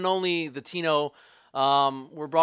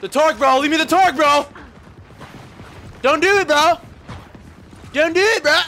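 high, loud begging shout; loud pleading yell; 9 semitones higher, 7 dB louder, faster than usual. Real, not acted. Gamer begging teammate for the weapon, yelling.

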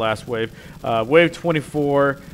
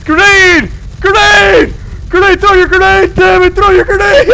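high, loud urgent yelling; grenade warning yell; 10 dB louder than usual. Real, not acted. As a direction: scream at the tower to throw. Urging tower to throw grenade.

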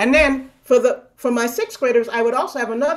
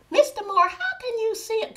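imitating a kid; 11 semitones higher than usual. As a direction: play a curious schoolkid asking the teacher. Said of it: "just like my kids say".

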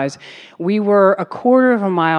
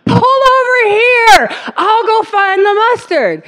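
high, drawn-out holler; yelled out; 6 dB louder, slower than usual. Retold or imitated. Passenger to van driver at a gas station, urgent yell. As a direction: call out urgently, stretching the words. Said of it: "I yell out to the driver".

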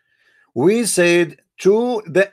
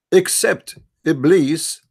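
slow emphasis; much slower than usual. Real, not acted. Stressing the exception in verse.

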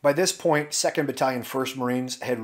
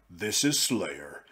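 slow, low, drawn-out radio voice; Slayer's cryptic deep voice; 4 semitones lower, much slower than usual. Retold or imitated. Gunship radio man answering Warhammer, cryptic deep voice. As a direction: imitate a deep, cryptic radio operator, very slowly. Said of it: "like a, like, real cryptic, like, deep voice".

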